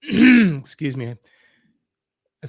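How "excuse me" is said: clearing throat through rib pain. Real, not acted. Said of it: "so it hurts to cough".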